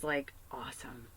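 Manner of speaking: hushed, breathy classmate's awe; whispered awe. Retold or imitated. Classmate whispering praise after class. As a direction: whisper it like a secret compliment. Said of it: "came up to me and was like".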